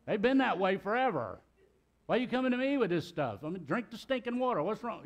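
loud, high exasperated scolding at complainers; mock angry rant. Pastor playing Moses scolding the complaining people, exasperated shouting. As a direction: chew them out like a fed-up Moses. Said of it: "I'd told them".

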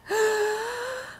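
sharp intake before yelling; big breath in. Acted. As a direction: gulp air to yell. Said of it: "Ready to yell this time".

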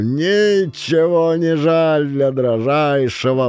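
jovial host. Acted. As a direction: urge the guests on, slow and generous. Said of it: "приговаривал".

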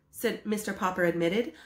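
plain reading voice

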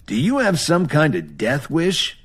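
high, menacing bellow; cold bellow. Acted. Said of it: "Meriwether bellowed as he stared at Kevin coldly".

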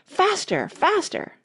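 high, urgent cry to hurry. A narrator's reading. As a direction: cry it out high, urging a runner on. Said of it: "still the Queen cried".

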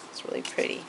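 breathy whisper of admiration; whispered aside. Real, not acted. Admiring gifts, softly aside.

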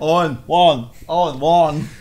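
loud, stilted retro video-game voice; eight-bit Gabe. Retold or imitated. Host mocking Gabe reduced to an eight-bit game, robotic chant. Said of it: "like, an eight-bit Gabe".

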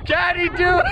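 high excited cheer; excited shouting. Real, not acted. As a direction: cheer him on at full voice.

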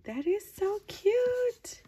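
very high, loud, stretched-out gushing; real cute-overload excitement; delighted squeal. Real, not acted. Delighted on seeing gift.

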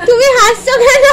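asks through real laughter, pitch climbing; giggling question to the dog; laughing. Real, not acted. Laughing while asking.

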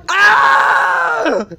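loud, high scream; frustrated scream. Real, not acted. Gamer screams on failing.

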